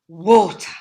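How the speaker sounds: ordinary delivery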